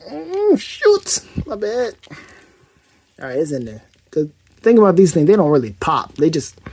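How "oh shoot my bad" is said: high, squeaky real oops; softer, high-pitched sheepish yelp; startled apology. Real, not acted. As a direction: jump up in pitch at a mishap. Mishap while fitting the toy part.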